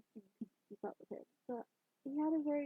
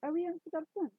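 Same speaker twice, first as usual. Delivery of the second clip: put-on tentative Scottish accent, pitched up; 7 semitones higher, 4 dB louder than usual. A narrator's reading. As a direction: have a reluctant go at a Scottish accent. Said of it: "do I have to do a Scottish accent".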